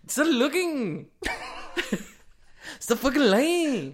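squeaky falsetto, slow and loud; high squeaky voice. Pretend. Mock squeal at friend.

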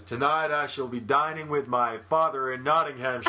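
put-on American accent. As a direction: deliver the line in an out-of-place American accent.

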